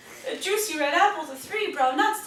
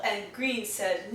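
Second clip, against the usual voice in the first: ordinary delivery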